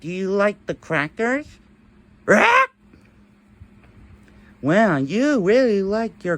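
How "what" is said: high squawky parrot voice; parrot squawk. Pretend.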